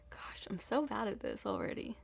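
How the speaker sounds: whispered aside. Real, not acted. Self-deprecating aside while hosting.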